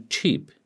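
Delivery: CHEAP with a ch onset; cheap, ch as in chin; ch sound. Shown as a demonstration. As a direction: start cheap on a stopped ch. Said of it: "you must distinguish between these".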